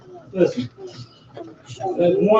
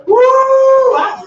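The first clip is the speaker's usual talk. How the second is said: high, loud, slow holler; 8 dB louder than usual. Real, not acted. Preacher hollering at the church. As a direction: stretch it out in a holler.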